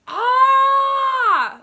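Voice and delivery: high piercing fangirl scream; excited scream. Retold or imitated. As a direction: shriek it out with glee. Reading diary entry aloud.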